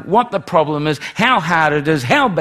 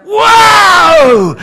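huge high, drawn-out whoop; yelled out; 14 dB louder than usual. Retold or imitated. Preacher re-enacting his own outburst in the meeting, yelled out. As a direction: re-enact a sudden yell at full volume. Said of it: "yelled out".

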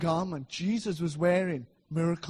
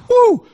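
high, loud joyful whoop; ecstatic shout; 15 dB louder than usual. Real, not acted. Preacher erupting before the congregation, loud joyful whoop. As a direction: let out a sudden loud whoop of joy.

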